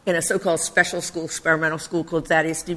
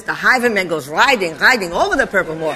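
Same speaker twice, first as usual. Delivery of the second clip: "The highwayman" recited in imitation of a Yiddish teacher, w turned to v; Yiddish accent. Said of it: "this poem like this".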